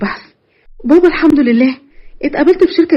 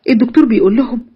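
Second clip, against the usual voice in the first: usual speaking voice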